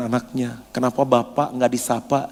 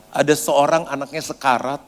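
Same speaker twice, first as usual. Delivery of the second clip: ordinary talk, nothing marked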